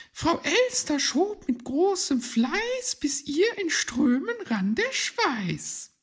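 high, loud character voice reciting verse; excited high-voiced little reciter; rhyming storyteller figure. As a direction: declaim the rhyme high and loud. Hoppel goes on with his rhyme.

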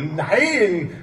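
a loud, firm no; shouted no. Acted. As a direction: cry it out, refusing. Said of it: "rief er".

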